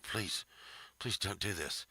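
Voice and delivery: softly imploring, a mock producer's plea; mock quiet plea. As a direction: beg under your breath. Producers' silent plea voiced.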